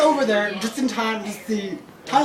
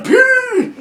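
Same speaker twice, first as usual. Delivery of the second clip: high, drawn-out squeal, slow; yelling at Ben, bursting to pee; mock desperate shout; 7 dB louder than usual.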